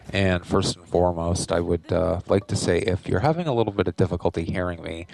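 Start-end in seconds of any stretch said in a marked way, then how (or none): none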